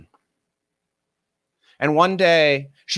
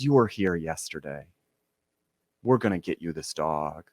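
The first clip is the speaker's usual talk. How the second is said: low, softer conspiratorial aside; hushed confidence; 8 semitones lower, 6 dB quieter than usual. Retold or imitated. Old woman quietly promises him the dog behind the angry man's back. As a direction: quietly let him in on a secret.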